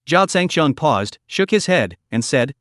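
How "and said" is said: plain reading voice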